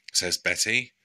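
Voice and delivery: usual speaking voice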